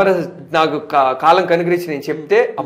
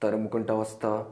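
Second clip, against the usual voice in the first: dropped low and hushed, the threat delivered last; hushed menacing film dialogue; menacing film line; 7 semitones lower, 15 dB quieter than usual. Pretend. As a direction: go quiet for the final threat. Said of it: "ఒక dialogue చెప్తావా".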